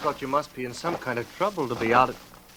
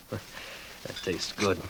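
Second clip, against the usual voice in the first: soft, slow, half-whispered relish; murmured; 4 semitones lower, 10 dB quieter, much slower than usual. Acted. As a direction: savour it under the breath, slowly. Quiet sip of coffee.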